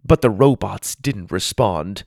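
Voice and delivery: ordinary delivery, nothing marked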